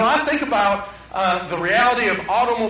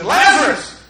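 calling out; 5 semitones higher, 6 dB louder, slower than usual. Retold or imitated. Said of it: "He said".

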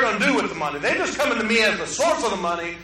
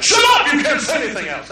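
a sudden high, loud playful yell to shut the preacher up; mock shout; 5 semitones higher, 5 dB louder, faster than usual. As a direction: bark it like an impatient congregation. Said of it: "be like".

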